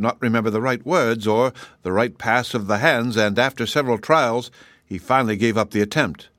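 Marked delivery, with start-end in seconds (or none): none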